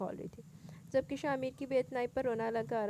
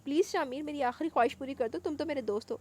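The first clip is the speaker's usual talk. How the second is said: innocent pleading; 5 semitones higher, 6 dB louder than usual. Acted. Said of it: "मासूमियत से कह रही थी".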